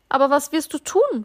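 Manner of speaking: high, slightly worried question; somewhat uneasy. A narrator's reading. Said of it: "fragte die kleine Seele nun doch etwas beunruhigt". (etwas beunruhigt gefragt)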